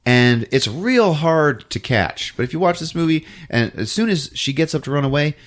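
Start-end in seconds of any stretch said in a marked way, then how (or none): none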